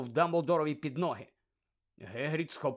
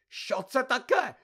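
high loud alarmed shout; loud alarmed shout on seeing Krum; 10 semitones higher, 6 dB louder, slower than usual. Acted. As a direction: cry out in alarm. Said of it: "закричав він".

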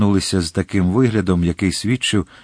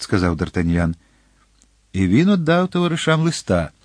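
plain reading voice

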